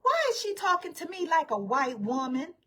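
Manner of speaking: high, affronted imitation of the actress; mock-offended legend; mock indignant diva. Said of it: "she was like".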